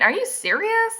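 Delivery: high-pitched questioning disbelief; incredulous. Real, not acted. Reader appalled at heroine's rejections, incredulous question. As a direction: ask "are you serious" high, in disbelief.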